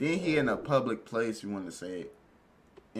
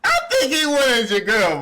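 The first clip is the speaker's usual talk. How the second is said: high, loud, incredulous scolding; loud protest; 11 semitones higher, 6 dB louder than usual. Real, not acted. Viewer calling out a guy over his brother, loud protest. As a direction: call him out loudly, pitched right up.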